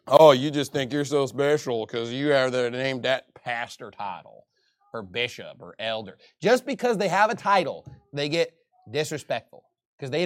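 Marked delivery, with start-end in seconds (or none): 0.1-6.2 sneering. Retold or imitated. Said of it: "no respect for a pastor".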